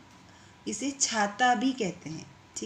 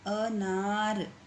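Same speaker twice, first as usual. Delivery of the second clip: slow careful; much slower than usual. Shown as a demonstration. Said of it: "मतलब न में आ रही है".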